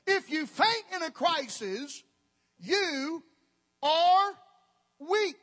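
high, loud, slow preaching build; preaching climax. Real, not acted. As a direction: hammer each word home from the pulpit.

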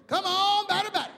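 high, loud ballpark yell; upbeat baseball chatter. Acted. Losing third baseman egging on the batter, upbeat and excited. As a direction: holler it out, full of cheer. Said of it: "he's just so upbeat and excited".

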